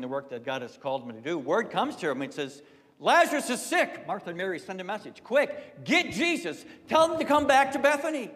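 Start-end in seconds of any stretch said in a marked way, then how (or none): 3.0-4.1 urgent message. Acted. 5.2-8.3 high, loud, urgent call to fetch him; urgent plea. Acted. Sisters urgently call for Jesus.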